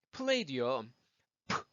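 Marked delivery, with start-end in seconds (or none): 1.5-1.6 프 as a puff of air, no vowel; unvoiced P burst for 프; voiceless P. Shown as a demonstration. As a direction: pop 프 with breath only. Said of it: "이거 소리 없습니다".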